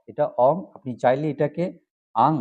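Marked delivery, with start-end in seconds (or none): 2.1-2.3 isolated "ang" for learners to copy; demonstrating "ang". Shown as a demonstration. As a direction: say "ang" as one separate syllable. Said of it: "আং উচ্চারণও করতে পারেন".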